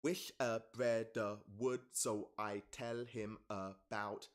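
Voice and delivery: very slow, each syllable spaced out; slow syllable-by-syllable read; counting syllables slowly. Shown as a demonstration. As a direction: tap out every syllable of the line. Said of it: "Thirteen syllables".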